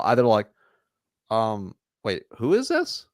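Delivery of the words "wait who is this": high, slow puzzled "who is this"; confused gatekeeper. Retold or imitated. Gatekeeper caught off guard by the caller, confused. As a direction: sound thrown by the call. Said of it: "they're gonna say something".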